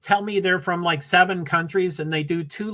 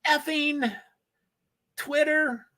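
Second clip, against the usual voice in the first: mock annoyance; 10 semitones higher, 3 dB quieter, slower than usual. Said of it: "And I'm like".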